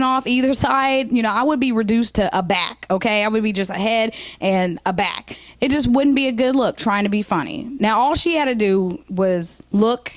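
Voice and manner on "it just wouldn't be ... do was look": ordinary talk, nothing marked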